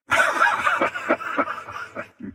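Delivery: a short, amused villain's laugh; mocking laugh. Villain laughing at the player's defiance, mocking laugh. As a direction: laugh at them like you've already won.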